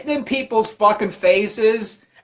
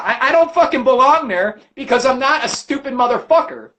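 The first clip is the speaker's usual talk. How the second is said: ordinary delivery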